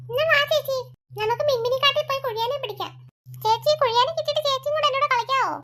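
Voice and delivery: put-on a small boy chatting with his sister; little boy. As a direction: play the little brother. Boy voice continues.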